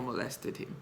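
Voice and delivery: breathy whisper on the accusation. Real, not acted. Telling viewers about the abuse claim, whispered. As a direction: drop to a whisper as if it's shameful.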